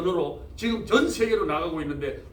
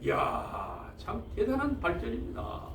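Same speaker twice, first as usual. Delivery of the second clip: hushed awe; 8 dB quieter than usual. Real, not acted. Preacher marvels at Bible translations, in hushed awe.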